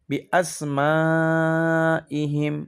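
Asma with a long held "maa"; four-beat madd stretch. Shown as a demonstration. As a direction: stretch the end of Asma, then finish ইহিম short. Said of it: "মধ্যে মুত্তাসিল চার আলিফ".

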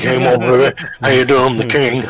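slurred, rockabilly-king impression. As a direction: put on a hammed-up Southern crooner swagger. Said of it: "like, an [name] voice".

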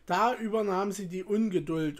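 plain reading voice

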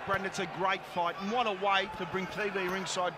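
usual speaking voice

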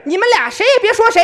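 shrill mother's rebuke; high loud scolding. Shown as a demonstration. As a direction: scold both sharply. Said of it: "这个是".

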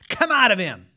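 stern loud command. Acted. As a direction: cast the demon out firmly. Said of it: "Jesus said sternly".

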